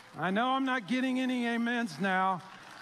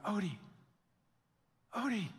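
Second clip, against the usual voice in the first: softer, tentative calls of a name; first calls for the dog; 6 dB quieter, faster than usual. Retold or imitated. Owner calling his wandering dog, softly at first. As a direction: call out half-expecting him back. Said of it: "it got louder and louder".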